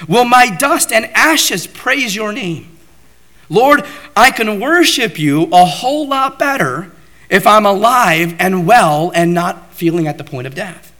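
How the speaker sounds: a man near death begging God to spare him, high and loud; pleading prayer. Acted. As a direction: beg God with urgency. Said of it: "This is what he's saying".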